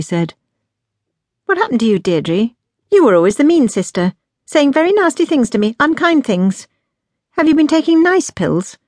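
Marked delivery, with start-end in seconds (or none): 1.4-8.8 high-pitched, incredulous younger sister; pointed, higher-voiced questioning; outspoken challenge. A narrator's reading. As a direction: challenge your sister in disbelief. Younger sister challenges her mean sister.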